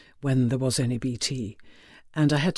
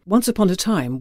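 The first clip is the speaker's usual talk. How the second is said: ordinary talk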